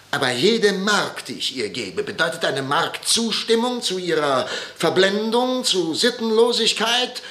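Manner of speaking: father raging over his daughter's politics; indignant outburst. Acted. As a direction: let the outrage climb word by word.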